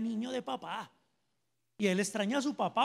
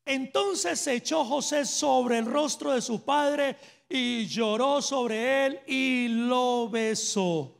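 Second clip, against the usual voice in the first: slow, louder scripture reading from the pulpit; solemn scripture reading; 3 semitones higher, 5 dB louder, slower than usual. Acted. Preacher reads the verse to the congregation, slow and loud. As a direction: read it out slowly and loudly, like scripture.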